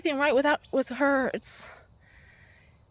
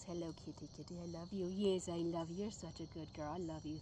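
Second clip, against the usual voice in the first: cooing to the cat; 6 semitones lower, 14 dB quieter than usual. Real, not acted. Baby talk to the cat.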